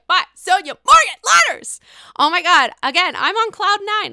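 thrilled shouting. Real, not acted. Host shouting to fans over a celebrity tag, thrilled and loud. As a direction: yell it out like you just got tagged by your idol. Tagged by Sonia Morgan.

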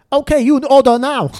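put-on high, loud broken-English Chinese accent. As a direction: play the salesgirl with a heavy Chinese accent. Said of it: "me doing the Chinese accent".

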